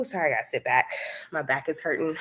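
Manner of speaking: usual speaking voice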